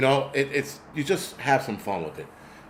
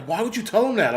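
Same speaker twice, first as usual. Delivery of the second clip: retold scolding question, pitched much higher; imitated son's mother; 10 semitones higher than usual. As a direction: mimic her objection in a high voice. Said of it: "my son's mother's like".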